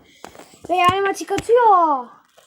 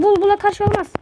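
higher and louder, exasperated; raised protest; louder insistent repeat; 5 semitones higher, 5 dB louder than usual. Real, not acted. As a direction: insist on it the second time.

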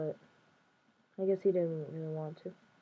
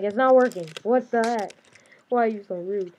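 mock frustrated outburst; 8 dB louder than usual. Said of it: "all of a sudden it's like".

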